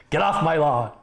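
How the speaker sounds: hammed-up get-off-my-lawn geezer; grumpy old man. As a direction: play a crotchety old-timer shooing kids.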